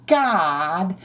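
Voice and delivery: high, gushing mock commentator; mock breathless announcer. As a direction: squeal it like an overexcited TV host. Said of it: "the lady was talking over it".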